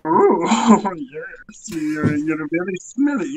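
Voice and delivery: high, giggly Mr Bean-style voice with a whispered middle; hammed-up Mister Bean character; silly British comic mumble, half whispered. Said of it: "kind of a Mister Beanie fucking vibe".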